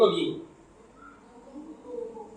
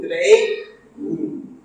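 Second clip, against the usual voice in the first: ordinary talk, nothing marked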